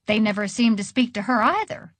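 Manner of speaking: plain reading voice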